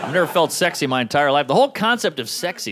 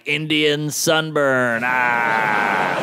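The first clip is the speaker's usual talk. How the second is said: slow mock twist, then a yell; slow bully taunt ending in a yelp; playground bully taunt; 3 semitones lower, much slower than usual. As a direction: drag it out like a schoolyard bully. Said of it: "I'm like".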